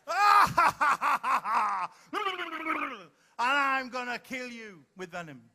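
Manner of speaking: pantomime villain. Acted. Host leading the volunteer's pantomime scene, hammed-up villain. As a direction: announce and cackle like a stage baddie. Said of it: "Dashley cried".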